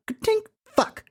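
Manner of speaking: high, tiny voice mimicking a lock and a curse; comic high-pitched lockpicking fumble; failed lockpicker. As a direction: imitate a click, then a squeaky little curse. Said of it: "sounds like someone messing with the lock".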